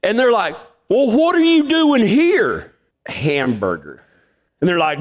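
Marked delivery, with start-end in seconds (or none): 0.9-2.7 high, slow, disbelieving; can't believe he's here; high drawn-out what are you doing here; astonished question. Retold or imitated. Guys ask in high disbelief. 3.1-4.0 low, flat, matter-of-fact; low deadpan hamburger; deadpan answer. Retold or imitated.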